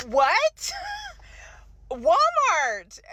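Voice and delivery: high, loud shocked "What?"; laughing outrage at the store; shocked disbelief. Real, not acted. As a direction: react in stunned disbelief. Shocked reaction to insult.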